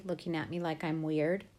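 plain reading voice

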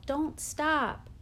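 high-pitched urging yell; urgent yell. A narrator's reading. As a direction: yell to keep a runner going. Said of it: "would yell".

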